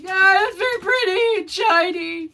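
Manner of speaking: high, whiny overdone praise; sarcastic sing-song admiration; mock gushing. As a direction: tease him with fake awe. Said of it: "It's like".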